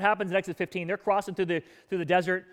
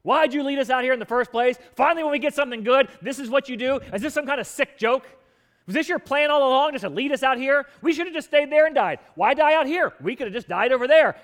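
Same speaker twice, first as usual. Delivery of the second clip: higher, louder, indignant accusation; angry complaining; 6 semitones higher, 7 dB louder than usual. Retold or imitated. Israelites shouting complaints at God over salt water. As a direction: rail at God like betrayed wanderers. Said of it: "They complain".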